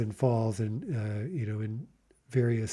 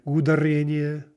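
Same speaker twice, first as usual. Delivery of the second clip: "Ударение" with the stress moved onto the first У, held high; misplaced stress; 4 semitones higher, 4 dB louder than usual. Shown as a demonstration. Teacher repeating a mistake for learners, "Ударение" wrongly stressed on У. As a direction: lean on the opening У of "Ударение". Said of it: "let's practice mispronouncing, misplacing the stress".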